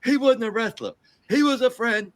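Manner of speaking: lightly voiced wrestler explaining; imitating George Steele. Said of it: "He said".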